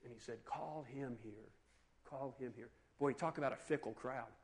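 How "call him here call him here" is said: soft, low, unhurried summons; hushed, lower-pitched instruction; quiet command. Acted. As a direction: give a calm order under the noise. Acts out Jesus's calm summons.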